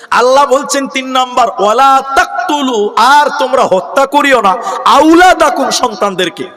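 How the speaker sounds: high, ringing declamation of scripture; emphatic preaching. Real, not acted. Preacher translating a verse for the crowd, high emphatic proclamation. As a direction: proclaim the commandment with rising force.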